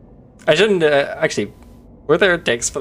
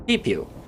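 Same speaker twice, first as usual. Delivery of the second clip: quieter, lower pew pew; a softer echo of the shots; soft mock gunshots; 7 semitones lower, 7 dB quieter than usual. Streamer repeats the gun sounds, quieter and lower.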